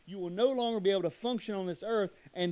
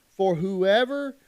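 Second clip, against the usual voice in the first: drawn out, louder for whoever; slow emphatic; 8 dB louder, much slower than usual. Acted. Preacher stressing God's warning to the church, slow and louder. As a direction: linger on whoever for weight. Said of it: "This was serious".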